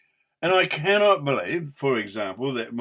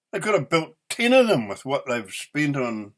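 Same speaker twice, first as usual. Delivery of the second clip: ordinary delivery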